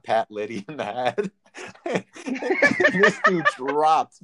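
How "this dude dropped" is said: laughing. Real, not acted. Laughing telling BB gun story.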